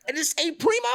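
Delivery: high, loud, incredulous mock yell; hammed-up high-pitched phone yelling; imitating yelled question. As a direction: holler the question in disbelief. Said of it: "he's yelling in the phone".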